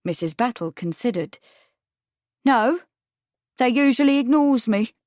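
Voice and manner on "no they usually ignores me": high, loud, huffy witness voice; sniffy. A narrator's reading. Witness saying the men snub her, sniffy. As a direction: sniff it out, affronted. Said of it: "she sniffed".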